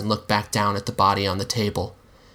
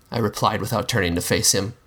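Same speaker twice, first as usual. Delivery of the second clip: usual speaking voice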